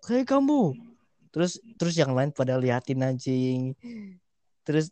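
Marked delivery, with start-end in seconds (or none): 0.1-0.8 high, sharp mock yell; teacher's shout. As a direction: call out like a teacher catching a kid. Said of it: "terus dia teriak".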